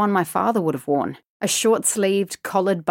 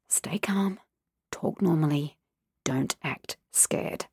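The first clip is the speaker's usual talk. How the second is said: soft, fading whisper to herself; tense whisper; 2 semitones lower, 7 dB quieter than usual. A narrator's reading. Girl calming herself in stranger's car, whispering. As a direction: hush yourself to stay calm. Inner whisper to stay calm.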